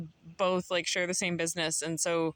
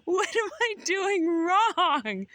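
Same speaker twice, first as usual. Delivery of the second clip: high, crying-voiced plea, slowing on the last words; retold tearful plea; 14 semitones higher, 5 dB louder, much slower than usual. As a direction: tearfully ask what she did wrong. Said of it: "I'm like".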